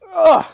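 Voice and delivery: sharp loud grunt of effort; straining grunt. Acted. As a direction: grunt hard as the couch comes up. Grunt lifting the couch.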